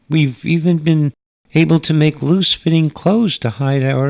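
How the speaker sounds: a gracious hostess from another world; alien mother. Acted. Alien mother praises Earth.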